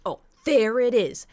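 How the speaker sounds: imitating the neighbor yelling at her partner on the phone; mock furious neighbor. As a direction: shout it like a neighbor catching him out. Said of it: "She goes".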